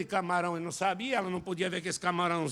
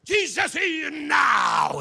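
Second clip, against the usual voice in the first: long, slow, high shout; stretched-out revival cry; preacher's shout; 8 semitones higher, 8 dB louder, much slower than usual. Retold or imitated. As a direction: holler it to the whole church. American pastor shouting in service.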